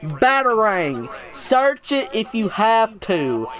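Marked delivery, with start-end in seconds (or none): 0.0-1.1 Batarang slowly, as one joined word; slow model pronunciation. Shown as a demonstration. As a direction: repeat Batarang deliberately, falling at the end. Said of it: "pronounce it how you're supposed to pronounce it".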